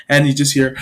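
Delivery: usual speaking voice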